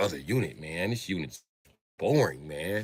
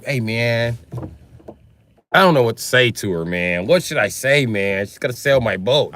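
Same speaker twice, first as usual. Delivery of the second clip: put-on whiny boyfriend; 3 semitones higher, 7 dB louder than usual. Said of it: "Peckerwood ... be like".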